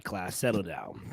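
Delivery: ordinary talk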